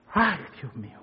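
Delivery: dismayed sigh. Retold or imitated. Wife reacting to husband's layoff, a soft dismayed gasp. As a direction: breathe it out like a shocked wife.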